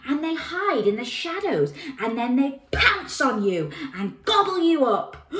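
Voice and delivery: put-on high, loud child voice; alarmed boy. A narrator's reading. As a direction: build it up like a scary warning. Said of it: "replied the boy".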